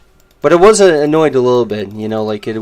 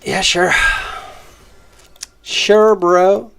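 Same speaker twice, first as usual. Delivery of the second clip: sarcastic; 8 semitones higher, slower than usual. Real, not acted. Sarcastic dismissal of a rival.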